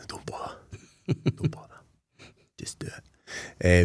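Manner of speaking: bleak, whispered refusal; dark resignation. Retold or imitated. Patient refusing the surgeon's offer, whispered and resigned. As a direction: breathe it out, barely voiced. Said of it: "a little bit darker about it".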